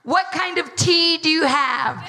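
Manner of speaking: high, bubbly eager question; mock-bubbly American tourist asking the trolley lady; excited American tourist. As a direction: squeal it like a thrilled tourist. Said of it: "they were like".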